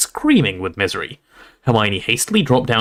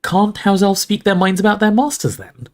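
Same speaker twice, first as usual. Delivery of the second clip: put-on higher-pitched boy's question; voiced questioner; 10 semitones higher than usual. A narrator's reading. Harry asks about elves' masters, raised questioning voice. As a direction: ask it raised, as a character.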